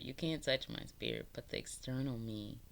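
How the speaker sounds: ordinary talk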